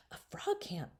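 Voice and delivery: soft whispered thought; girl whispering her thought to herself; thought aloud. A narrator's reading. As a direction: hush it to yourself in disbelief. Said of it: "she thought".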